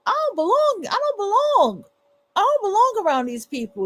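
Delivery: high, ringing realization that she doesn't belong; sudden realization. Retold or imitated. To her viewers, reliving her awakening, voice pitched high. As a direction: cry it out as the truth hits you. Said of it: "I am waking up like".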